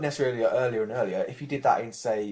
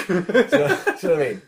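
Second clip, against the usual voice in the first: laughing; 8 semitones higher, 10 dB louder than usual. Real, not acted. Laughing after Half-Life joke.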